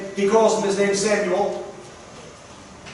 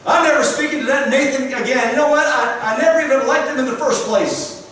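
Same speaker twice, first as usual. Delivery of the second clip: indignant rant; 4 semitones higher than usual. Pretend. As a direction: voice a bitter, sulking king who refuses to forgive. Preacher voices an imagined angry David.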